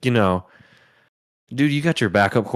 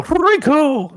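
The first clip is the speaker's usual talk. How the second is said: the name "Rico!" cried out much higher and louder; excited shout; 5 dB louder than usual. Real, not acted. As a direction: call "Rico!" like a fan in the stands.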